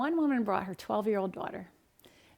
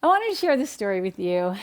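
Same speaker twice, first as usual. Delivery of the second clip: ordinary delivery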